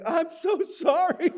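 high, loud, slow crying apology; imitated tearful parent; mock wailing sympathy. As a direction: cry it out in sympathy. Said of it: "I'm like".

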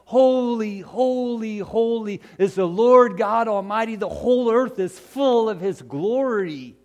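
angels crying out. Acted. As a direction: lift it like angels in the temple. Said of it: "they're crying".

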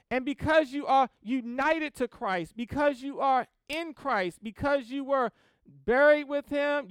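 sermon building to a loud climax; forceful preaching. Real, not acted. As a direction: pile up each phrase, then shout the last.

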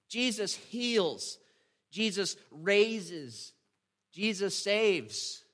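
each word slow and weighty; slow proclamation. Acted. Pastor closing the message to congregation, slow proclamation. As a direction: hammer home each name and verb.

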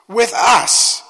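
normal talking voice

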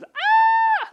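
sudden high, very loud yelp of alarm; wasp panic yell. Retold or imitated. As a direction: shriek like a wasp just buzzed past. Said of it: "I think he just goes".

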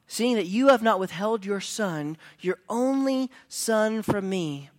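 loud, driving sermon build; fiery preacher retelling Abraham; preaching at full force. Acted. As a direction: preach it hard and urgent. Preaches the story with rising force.

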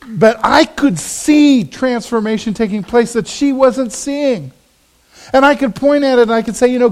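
fervent pulpit build; preaching at full force. Acted. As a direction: drive it home to the congregation.

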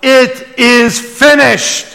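Jesus crying out from the cross, high and slow. Acted. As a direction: call it out high and drawn. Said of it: "he cries out".

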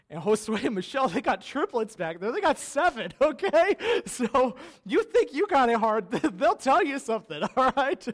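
loud and high, laughing as he builds it up; laughing loudly to the congregation about the big family, excited; laughing excitement. Real, not acted. Pastor teasing the parents of seven in front of church, loud laughing excitement.

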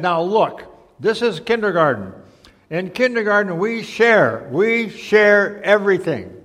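a hint of a firm teacher; lightly voiced kindergarten teacher, instructing. A narrator's reading. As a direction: lightly play a teacher, stretching the final words.

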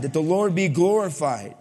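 usual speaking voice

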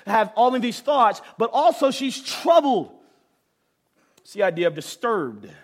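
ordinary talk

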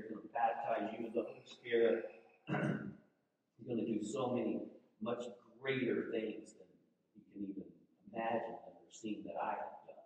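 ordinary talk

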